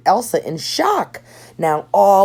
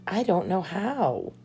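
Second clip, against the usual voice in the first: worried; 2 semitones higher, 6 dB quieter than usual. Acted. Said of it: "Elsa looked worried".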